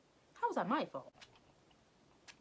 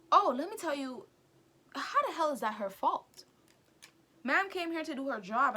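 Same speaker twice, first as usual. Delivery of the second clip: indignant rant; 5 semitones higher, 6 dB louder than usual. Real, not acted. Indignant rant about teacher.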